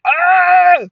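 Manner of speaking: loud, high "ah"; delighted yell. Acted. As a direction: burst out, overwhelmed with delight. Exclaims over being called baby.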